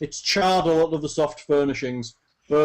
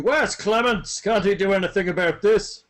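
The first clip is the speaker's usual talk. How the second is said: high-pitched shouted demand; Tybalt shouting; 5 semitones higher than usual. Acted. Lord wandering round his burning manor, shouting. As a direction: shout about, demanding someone fix this. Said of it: "Tybalt is wandering around shouting".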